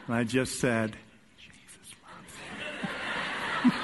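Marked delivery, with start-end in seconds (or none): 1.4-2.3 giggling whispered blessing; mischievous whisper with a laugh. Retold or imitated. 2.6-3.6 breathy giggle. Retold or imitated. Laughs after whisper. 3.6-3.7 giggling whispered blessing; mischievous whisper with a laugh. Retold or imitated.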